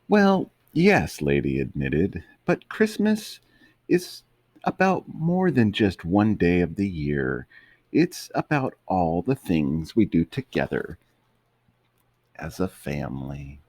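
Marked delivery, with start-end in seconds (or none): 0.1-1.2 very high gentle lady dog. Acted. Lady admitting to Tramp, high sweet voice. 2.4-13.6 high lady dog voice, fading down. Acted. Lady explaining Christmas to Tramp, high gentle voice.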